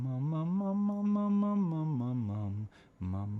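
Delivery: mum slides up high and back down; siren on mum. Shown as a demonstration. Coach demonstrating light compression on mum. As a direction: glide the mums up into head voice and back. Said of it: "learning to do this".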